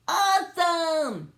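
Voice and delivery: high, loud, drawn-out awe; awestruck. Acted. Bork spots the rover, high drawn-out delight. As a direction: stretch it out in delight. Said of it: "she said".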